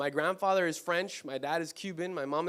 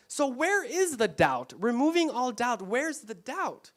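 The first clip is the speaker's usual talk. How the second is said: preaching emphasis; 5 semitones higher, 4 dB louder, slower than usual. Real, not acted. Preaching climax, repeated question.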